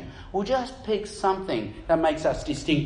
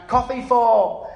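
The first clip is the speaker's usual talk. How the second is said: raised, louder barista call; 6 semitones higher, 6 dB louder, slower than usual. Retold or imitated. Barista calling a name off the cup, loud high call. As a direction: call it across the coffee shop.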